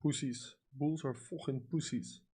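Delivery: usual speaking voice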